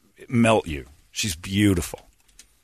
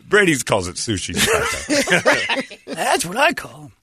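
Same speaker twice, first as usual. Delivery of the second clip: slow, higher, laughing; laughing tease; 11 semitones higher, slower than usual. Real, not acted. Host teasing Brady about the name, laughing.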